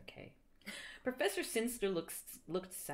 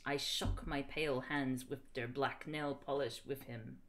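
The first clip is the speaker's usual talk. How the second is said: usual speaking voice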